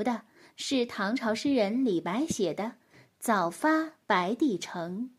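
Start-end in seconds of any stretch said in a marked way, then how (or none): none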